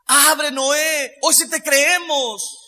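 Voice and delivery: drawn-out mock shout to be let in; retold pleading shout. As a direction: cry out as if begging at a shut door. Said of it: "gritándole a Noé".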